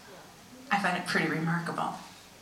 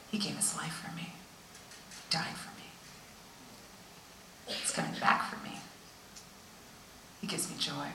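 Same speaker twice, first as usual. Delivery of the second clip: soft, whispered testimony; preacher quietly testifying of love; hushed preaching; 2 semitones lower, 12 dB quieter than usual. Real, not acted. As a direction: drop to a reverent whisper. Hushed worship climax.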